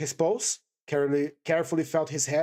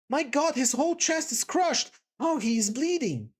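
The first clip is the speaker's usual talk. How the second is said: higher, despairing cry; 9 semitones higher than usual. A narrator's reading. Katerina over her dying husband, in despair. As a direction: cry out over his crushed chest. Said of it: "she said in despair".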